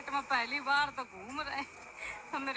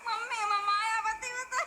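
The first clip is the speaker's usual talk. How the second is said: laughing; 7 semitones higher than usual. Real, not acted. Laughing about her new haircut.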